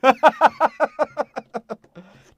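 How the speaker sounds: a burst of loud, high laughter. Real, not acted. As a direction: crack up out loud. Big laugh at own remark.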